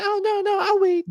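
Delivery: squeaky, prim wife being modest; imitating wife insisting on waiting; mock wife voice. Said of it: "The voice comes out".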